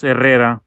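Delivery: Herrera, trilled double R then one tapped R; rolled double R. Shown as a demonstration. Explaining the spelling of Dan's name, Herrera with rolled double R. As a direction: roll the first R sound in Herrera. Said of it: "it's got the double R".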